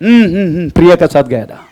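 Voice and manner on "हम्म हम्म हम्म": usual speaking voice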